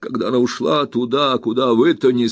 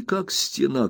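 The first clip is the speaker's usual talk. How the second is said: ordinary delivery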